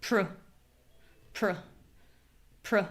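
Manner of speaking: "pr" as a tiny pruh, lips barely open; reduced first syllable. Shown as a demonstration. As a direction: clip "pr" short with a schwa. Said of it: "we say pr".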